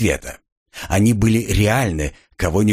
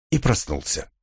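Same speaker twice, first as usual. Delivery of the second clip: ordinary delivery, nothing marked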